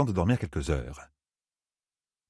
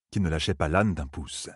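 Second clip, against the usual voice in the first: plain reading voice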